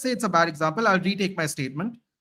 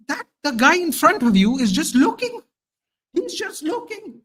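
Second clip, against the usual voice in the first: high, incredulous complaint; retold exasperation; 8 semitones higher than usual. Fan retelling the manager's inaction to panel, high exasperation. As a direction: climb in pitch as you relive the outrage. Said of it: "I was so mad".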